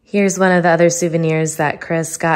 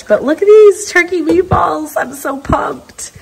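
much higher, thrilled about the meatballs; excited; 12 semitones higher, 5 dB louder than usual. Real, not acted. Daughter showing viewers her mum's meatballs, high and excited.

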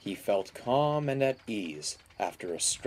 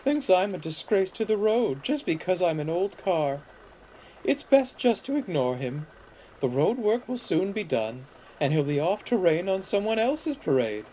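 high, put-upon character voice; sighing old car; 8 semitones higher than usual. A narrator's reading. Caroline advising Mavis to ignore George, sighing old car. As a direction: complain wearily in a raised voice. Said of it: "sighed Caroline".

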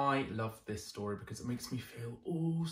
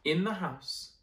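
usual speaking voice

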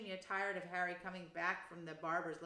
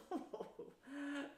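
chuckle; 7 semitones higher, 5 dB quieter than usual. A narrator's reading. Uncle amused by his greedy boy, chuckling. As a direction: chuckle to yourself like a pleased uncle. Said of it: "Uncle Vernon chuckled".

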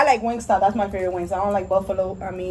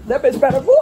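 laughing; 4 dB louder, faster than usual. Real, not acted. Butterfly interrupting the video.

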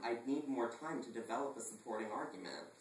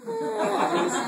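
usual speaking voice

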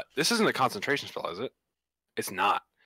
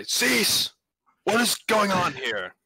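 high, drawn-out yell into a crowd; 6 semitones higher, much slower than usual. Acted. Adventurer shouting at a crowd of fighting animals, yelling. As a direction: call out with raised pitch, commanding a halt. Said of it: "I yell into the".

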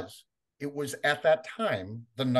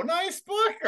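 much higher "nice book"; high mock echo. Echoing the collector's praise in a much higher voice. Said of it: "And I'm like".

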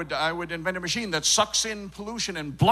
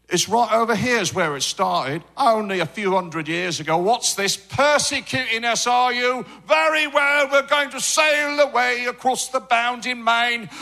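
put-on higher-pitched, declaiming English settler accent; 5 semitones higher than usual. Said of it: "wanna see where our dialect came from".

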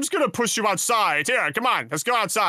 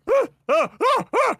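put-on shrill girly scream; high-pitched scream; 13 semitones higher, 3 dB louder than usual. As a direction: scream like a little girl. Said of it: "got him to scream like a little girl".

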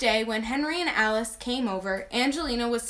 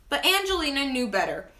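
usual speaking voice

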